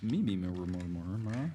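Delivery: lightly put-on impression, repeating one syllable; Ryan Step. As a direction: do a quick joking impression. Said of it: "that was my Ryan Step impression".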